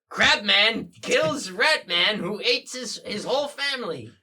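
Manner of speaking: slow, loud, high headline read; headline announcer. Acted. Newspaper headline announced about Crab Man, slow and loud. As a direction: boom it out like a tabloid front page. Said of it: "the newspaper's like".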